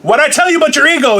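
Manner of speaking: rapid, high-pitched telling-off; mock scolding. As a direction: scold quickly in jest. Acting out scolding someone's ego.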